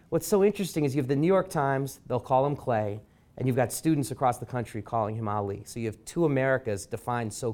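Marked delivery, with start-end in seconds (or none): none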